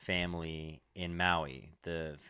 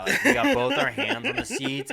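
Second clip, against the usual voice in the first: laughing; 10 dB louder than usual. Real, not acted. Laughing while recalling boarding.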